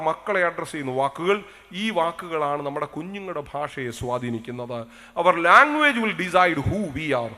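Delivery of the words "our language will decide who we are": very slow, louder, word by word; solemn proclamation. Acted. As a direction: declare it to the congregation. Sermon proclamation in English. (പതുക്കെ ഗൗരവത്തോടെ പ്രഖ്യാപിച്ച്)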